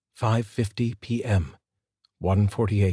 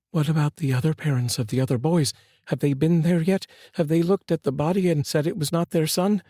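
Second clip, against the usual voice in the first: mother's voice, higher and urgent; anxious mother; 8 semitones higher than usual. A narrator's reading. Mother pressing the detective about other parents, anxious.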